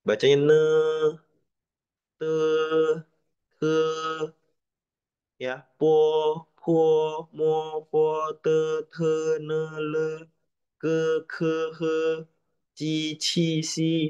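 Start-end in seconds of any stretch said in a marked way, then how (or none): 0.5-3.8 "ne", "Te", "Ke" each held level; first tone. Shown as a demonstration. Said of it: "Dia nggak ada naik, nggak ada turun". 5.8-14.1 "Po, Po, Mo" through "Ci, Si" all on one level pitch; first tone. Shown as a demonstration. Teacher recites syllable table in level tone one. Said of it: "Itu nada satu".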